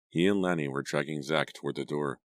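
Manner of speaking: plain reading voice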